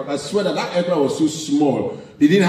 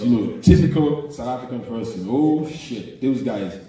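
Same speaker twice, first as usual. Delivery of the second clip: usual speaking voice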